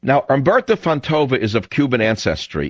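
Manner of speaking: ordinary talk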